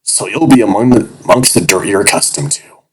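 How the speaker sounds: jovial. Acted. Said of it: "remarkably jovial tone".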